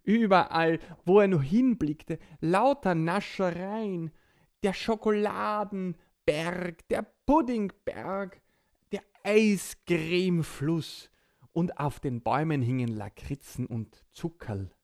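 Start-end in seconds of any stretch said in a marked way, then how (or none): 0.0-11.1 excited narration. A narrator's reading. Narrator marvels at sweets.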